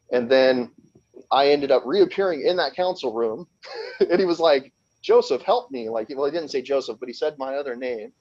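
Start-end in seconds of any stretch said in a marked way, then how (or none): 5.0-5.9 retold plea.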